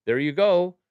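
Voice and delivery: There you go, pushed up high and loud on go. Real, not acted. Streamer cheering the chat, loud and high. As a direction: bellow the repeat of There you go. Repeating praise to chat, louder.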